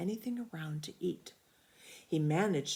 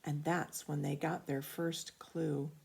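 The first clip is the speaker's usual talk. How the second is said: ordinary delivery, nothing marked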